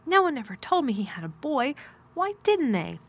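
put-on high, amazed child's protest; astonished. A narrator's reading. Girl learning the uncle has a boy, astonished.

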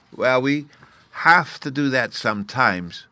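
put-on a child admitting a chore; grudging child. A narrator's reading. Fairy boy admitting he must teach children, grudging. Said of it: "said Bruno".

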